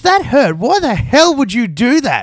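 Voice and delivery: high, loud outrage at being hit; angry protest. Acted. Narrator to the person who hit him, angry protest. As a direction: yelp an indignant demand at the attacker.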